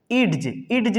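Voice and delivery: "इड्ज" with the D sounded before the j; wrong reading. Shown as a demonstration. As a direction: say "इड्ज" as id-j, voicing the silent D. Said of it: "नहीं पढ़ेंगे इसको".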